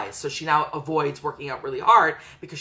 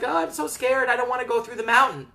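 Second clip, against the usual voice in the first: higher, hammed-up fearful whimper; mock-scared Frodo; 7 semitones higher, faster than usual. Frodo telling Gandalf he's scared, mock-fearful whine. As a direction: play the frightened hobbit, faster at the end. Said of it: "And he's like".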